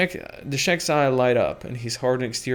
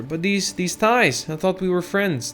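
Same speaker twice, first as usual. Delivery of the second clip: hurt; 6 semitones higher than usual. Acted. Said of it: "tries to maintain his composure".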